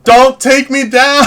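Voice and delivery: high, slow, drawn-out call; mock pleading shout. Streamer calling to YouTube to spare the channel, in a mock pleading shout. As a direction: call out a hammed-up plea.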